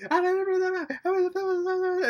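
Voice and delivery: very high, loud gibberish rant; mock screaming. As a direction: imitate a police chief yelling wildly. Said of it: "He just starts screaming and yelling at them".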